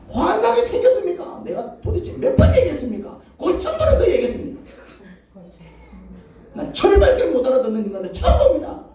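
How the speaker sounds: very high, loud acted rant; furious outburst. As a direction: vent at the Lord, voice shooting up. (화가 치밀어 고함치는 하소연)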